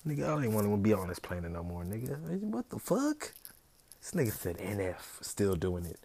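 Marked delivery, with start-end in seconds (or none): 2.4-3.3 high, loud disbelieving cry; high outraged what the fuck; shocked squeal. Real, not acted.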